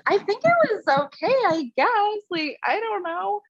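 high-pitched, hesitant reluctant verdict; mock lukewarm date report; unconvinced. Reenacts unsure reaction after a date.

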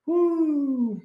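excited whoop. Real, not acted. Cheering donation.

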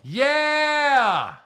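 excited cheer. Acted. Cheering a patron thank-you.

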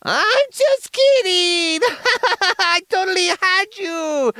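high, loud, laughing squeal. Acted. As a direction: cackle as if the prank landed. The billionaire bit turns to a joke.

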